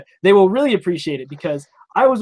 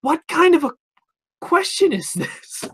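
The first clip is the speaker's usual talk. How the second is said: baffled disbelief; 12 semitones higher, slower than usual. Retold or imitated. Baffled inner reaction to cop.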